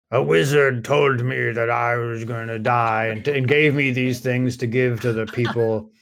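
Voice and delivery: hammed-up dying dragon voice. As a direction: play a dragon passing on its last message. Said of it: "that's the best dragon voice ever".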